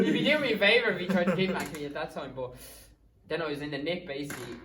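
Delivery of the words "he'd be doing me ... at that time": laughing through the words, higher, louder, fast; giggling as he repeats his own punchline. Real, not acted. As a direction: crack up while retelling the joke. Retells joke laughing.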